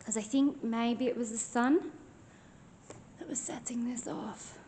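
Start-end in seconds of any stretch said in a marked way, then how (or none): 3.2-4.6 whispering. Real, not acted. Quiet test of the device.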